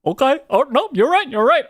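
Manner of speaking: high, timid caving in; meek high voice. Retold or imitated. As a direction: squeak out agreement.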